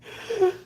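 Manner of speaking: imitated gasp. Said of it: "he's like".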